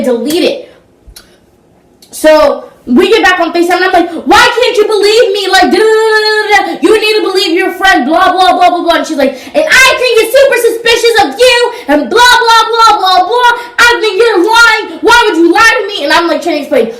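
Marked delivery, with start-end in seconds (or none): 4.3-9.0 heated retold plea. 9.3-16.0 mock accusing friend. Mocking friend's accusation in argument.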